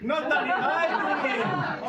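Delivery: high, loud, drawn-out repeat; delighted echo. Retold or imitated. Comedian repeating a man's cheeky answer, gleeful loud echo. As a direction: crow the answer back to the crowd.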